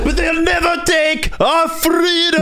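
high, slow rallying shout; Braveheart battle shout. Retold or imitated. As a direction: rouse the troops like Braveheart. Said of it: "you gotta think Mel Gibson, Braveheart".

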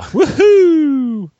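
loud high woohoo; cheering. Real, not acted. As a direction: whoop it up. Cheering the winner.

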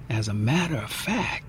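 a secret murmur; whispering. A narrator's reading. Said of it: "She leans in and whispers".

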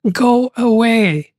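slow, dragged groan of go away; drawn-out go away. Retold or imitated. Bandmates in unison telling John to leave, slow and drawn out. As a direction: stretch it out like sleepy people in chorus. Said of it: "They all said in unison".

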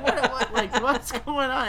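half-laughing mock alarm; laughing panic. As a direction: laugh through the retold panic. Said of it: "she was just like".

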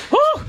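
sudden squeaky high yelp. Acted. Athlete yelps as he bolts from the interview. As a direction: yelp way up high like a startled bird.

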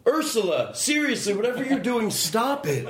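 high-pitched, laughing plea to the dog; half-laughing, scold the snoring dog. Real, not acted.

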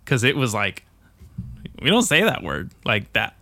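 usual speaking voice

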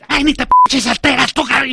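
loud high mocking impression of the caller. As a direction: squeal it like an annoyed con man. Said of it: "agarra y me dice".

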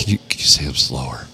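low, mock-seductive phone request; mock aroused caller. Caller to pizza waitress reciting specials, low mock-aroused voice. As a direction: play a creepy caller, pitched low.

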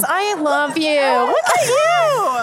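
excited. Real, not acted. Gushing at an artist on carpet.

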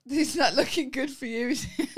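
high, loud, laughing gloat; gleeful teasing. Real, not acted. Co-host gloating about winning the bet, laughing. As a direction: tease through laughter.

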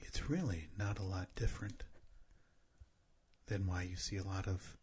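low, soft breathy whisper; ASMR whisper. Pretend. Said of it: "can you respond in your A S M R voice".

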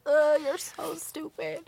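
half-laughing through real tears; crying. Real, not acted. Scolding her friend for the sad story, crying. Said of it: "that you're crying".

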